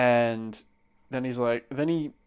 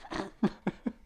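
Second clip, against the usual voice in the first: brief laugh; 8 semitones higher, 6 dB quieter than usual. Real, not acted. Laughing at his own story.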